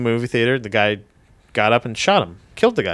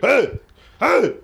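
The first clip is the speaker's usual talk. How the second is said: high mock tough call; macho voice; 9 semitones higher than usual. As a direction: bark it out like a tough guy. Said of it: "my manliest voice".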